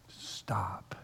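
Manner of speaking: whispered, drawn-back command; soft whispered plea; hushed urging. Real, not acted. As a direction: drop to a whisper to urge stillness. Urging listeners to stop and settle.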